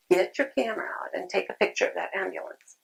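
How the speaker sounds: soft unvoiced whisper; hushed secret instruction; whispered aside. Real, not acted. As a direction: drop to a whisper for this tip. Whispered aside during training talk.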